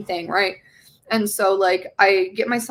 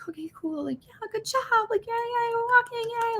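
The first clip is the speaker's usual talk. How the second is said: put-on very high, soft praise for a toddler; cheering baby talk; 4 dB quieter than usual. Mother praising her toddler son learning to walk, very high cooing. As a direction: coo at the baby taking steps. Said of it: "I'm just like".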